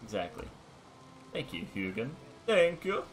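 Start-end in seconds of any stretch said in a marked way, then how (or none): 2.5-3.0 much higher, louder Scandinavian voice; hammy Scandinavian thanks, pitched up; Scandinavian accent. Acted. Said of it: "put on this terrible Scandinavian voice".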